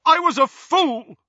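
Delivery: high, very loud confession; anguished, high-pitched shout; roar. A narrator's reading. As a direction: burst out with it at full voice. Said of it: "Percy roared".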